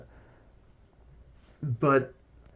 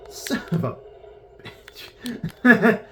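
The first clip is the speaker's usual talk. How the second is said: laughing curse; 10 semitones higher, 3 dB louder, much slower than usual. Real, not acted. Wrong harvester in game.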